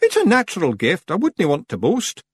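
high, falsely modest Scottish voice; modest Rob Anybody. A narrator's reading. Rob pretending not to boast, mock-modest. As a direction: brush the praise off lightly. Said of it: "waving his hand modestly".

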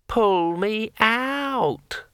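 very high, slow glassy voice; drawn out like a ringing wine glass; talking sword. A narrator's reading. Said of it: "repeated Kring".

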